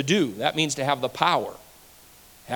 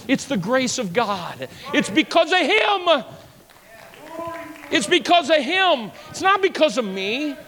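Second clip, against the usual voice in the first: climbing sermon cry; full-force preaching; 8 semitones higher than usual. Acted. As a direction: preach it out, rising hard.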